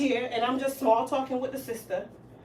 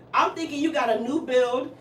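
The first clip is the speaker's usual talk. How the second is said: ordinary talk, nothing marked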